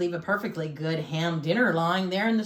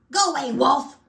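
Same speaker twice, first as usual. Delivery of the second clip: high-pitched indignant pig yell; yelling back; 6 semitones higher, 4 dB louder than usual. A narrator's reading. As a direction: shout the wolf away from behind the door. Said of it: "And he yelled back".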